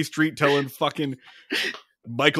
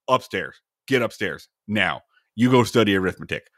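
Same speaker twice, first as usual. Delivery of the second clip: stern father order; 7 semitones lower than usual. Retold or imitated. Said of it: "he goes".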